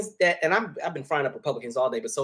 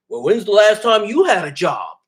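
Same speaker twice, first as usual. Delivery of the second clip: loud, higher mock challenge; hammed-up indignant question; mocking critic; 5 semitones higher, 9 dB louder than usual. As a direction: mimic a heckler's snotty comeback. Mimics critics' insulting comeback.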